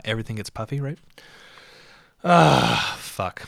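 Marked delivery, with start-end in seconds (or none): 2.2-3.0 loud, drawn-out exasperated "ah"; loud groan. Real, not acted. Comic groaning before a curse, loud exhale. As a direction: let out a big groan.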